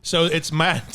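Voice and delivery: ordinary talk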